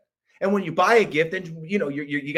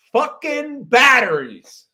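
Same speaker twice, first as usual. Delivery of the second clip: slow, louder drawn-out complaint; exasperated; 8 semitones higher, 7 dB louder, much slower than usual. Acted. Parent discovering the toy needs batteries, exasperated. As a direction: drag it out in disgust.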